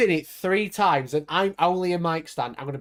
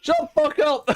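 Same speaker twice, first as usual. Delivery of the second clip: shouting; 7 semitones higher than usual. Real, not acted. Playful retort to teasing.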